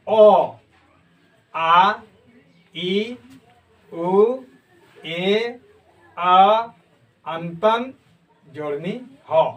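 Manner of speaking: each letter sound said alone, spaced out; vowel recital. Shown as a demonstration. Teacher reciting the letters for students one by one. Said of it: "इसका उच्चारण करके मैं फिर से".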